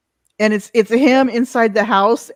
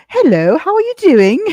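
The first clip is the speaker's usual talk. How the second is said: mock posh greeting, pitched up and louder; 6 semitones higher, 5 dB louder than usual. Imagining the comedian off camera, lightly mock posh greeting. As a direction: lightly put on a prim, proper hello. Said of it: "He's very posh".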